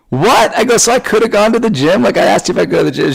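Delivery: high-pitched mock-outraged protest; indignant disbelief. Husband protests to wife about missed gym, mock outrage. Acted indignant reaction to wife's free day.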